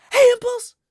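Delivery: sudden, very high loud shout; energetic impression. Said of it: "Way too calm, not enough action".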